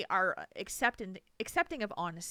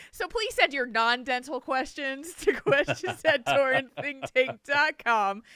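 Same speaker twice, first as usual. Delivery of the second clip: high, loud, laughing sign-off; laughing through the show address; laughing plug; 5 semitones higher, 8 dB louder, slower than usual. Real, not acted. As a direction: read the address while laughing. Closing plug while laughing.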